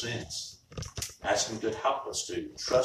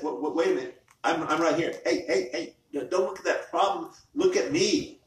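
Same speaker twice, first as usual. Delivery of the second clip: raised, urgent calling for attention; Jesus interrupting; 7 semitones higher, 7 dB louder than usual. Acted. Jesus calling to a troubled believer, urgent and insistent. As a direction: break in higher and louder, insisting on being looked at. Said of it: "And Jesus said".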